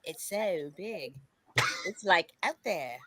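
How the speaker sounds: put-on Valley Girl accent. Showing the accent never picked up, mock Valley Girl. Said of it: "I never did that Valley Girl".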